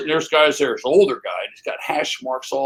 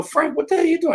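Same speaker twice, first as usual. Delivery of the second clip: surprised call; 13 semitones higher than usual. Retold or imitated. Neighbors shocked at limo.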